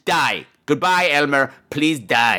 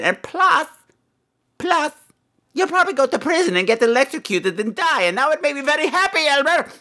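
gleeful malice; 12 semitones higher than usual. Acted. Gleeful wish caller dies.

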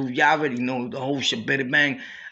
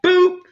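a high, louder "boop" sound effect; mock boop; 14 semitones higher, 7 dB louder than usual. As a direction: pop out a quick high boop like a click. Sound effect for a phone call.

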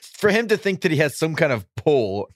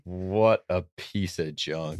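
disgusted; 8 semitones lower, 7 dB quieter, much slower than usual. Acted. Mocking the fan's tweet.

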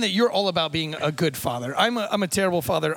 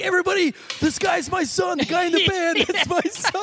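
hammed-up proud father calling out to the bar; dad bragging to the whole bar about his son, hammed-up shout; 13 semitones higher, slower than usual. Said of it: "He was like".

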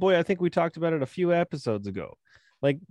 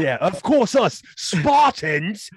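high, slow posh British voice; mock English accent, drawn out; 5 semitones higher, 6 dB louder, much slower than usual. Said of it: "speaks with a British accent".

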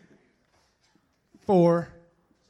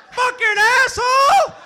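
high, loud furious yell; angry shout; 13 semitones higher, 6 dB louder than usual. Real, not acted. Host yelling at the judge who went to the bathroom, furious. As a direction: blow up at the missing judge.

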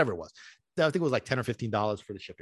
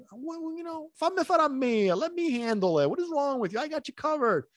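much higher, exasperated voice of God; mocking divine reprimand, pitched way up; God scolding; 12 semitones higher than usual. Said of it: "basically God was, like, looking at me like".

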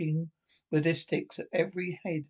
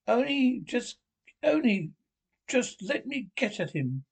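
through gritted teeth, higher pitch; 6 semitones higher than usual. A narrator's reading. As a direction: spit it through clenched teeth. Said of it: "murmured Toad, grinding his teeth".

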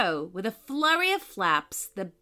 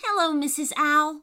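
higher, lightly voiced little girl; little girl character; 6 semitones higher, 4 dB louder than usual. A narrator's reading. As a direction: greet brightly as a lightly played child. Poppy greets owl in story.

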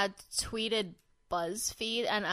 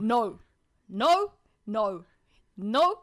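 Australian trying an American "no"; effortful American-style "no", rising in volume; attempted American accent; 4 dB louder, much slower than usual. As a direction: repeat "no", struggling toward an American accent. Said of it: "and then he's like".

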